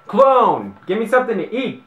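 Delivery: loud, high demanding yell; shouting demand. Acted. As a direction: yell for food like a brat.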